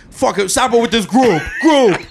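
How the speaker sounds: fed-up boss barking at his crew; hammed-up Tony Soprano rant; imitating angry Tony. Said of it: "And Tony's like".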